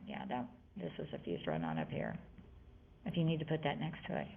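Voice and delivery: low whispered aside to the class. Real, not acted. Teacher quietly pointing at the board, hushed whisper. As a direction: drop to a whisper for a side note. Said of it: "teacher aside, whispered note".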